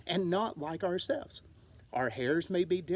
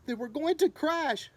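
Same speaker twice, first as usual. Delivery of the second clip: alarmed gasp; 8 semitones higher than usual. Acted. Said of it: "gasped Stella Luna".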